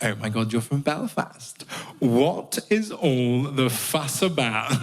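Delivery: slow, drawn-out English questioning; mock English accent. English people questioning someone from Belfast, slow mock English accent. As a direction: ask it in an exaggerated English accent, dragging words. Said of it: "they always ask the same question".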